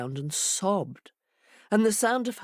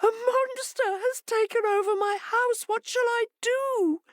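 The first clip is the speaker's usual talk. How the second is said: much higher, frightened plea; helpless and scared; frightened hare. A narrator's reading. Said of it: "she trembled in fright".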